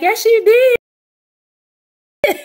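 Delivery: high, loud mock sibling admission; hammed-up grudging "yes, you did"; siblings conceding. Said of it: "And they be like".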